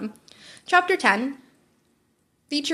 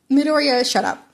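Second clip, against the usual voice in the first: ordinary delivery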